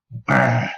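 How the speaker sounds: rolled "grr" growl of loathing; angry snarl. Acted. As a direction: break off the prayer into a growl. Snarls at rival to end poem.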